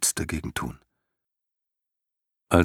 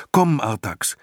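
higher, louder coaxing call; urging; 7 semitones higher, 4 dB louder than usual. A narrator's reading. Boy Atreo urges his horse Artax on, louder. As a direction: coax him forward firmly.

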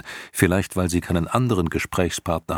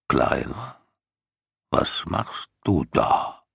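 gurgling creature voice; slower than usual. Acted. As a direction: let it gurgle out slowly like a huge old turtle. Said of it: "blasende, gurgelnde Stimme".

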